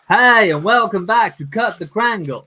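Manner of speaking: hammed-up programme intro; mock show host. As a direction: welcome them like a show host. Mock show intro on his stream.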